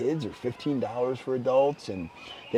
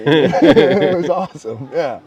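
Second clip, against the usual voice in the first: loud, drawn-out words through laughter; laughing; 4 semitones higher, 11 dB louder, slower than usual. Real, not acted. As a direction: say it slowly while laughing, delighted. Laughing at shared memory.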